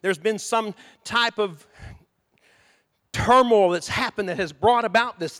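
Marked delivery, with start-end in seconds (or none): none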